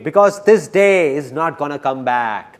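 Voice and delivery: loud, slow emphatic declaration. Real, not acted. Emphatic line repeated for effect.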